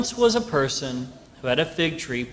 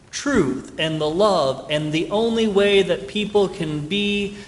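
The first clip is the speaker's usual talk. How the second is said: usual speaking voice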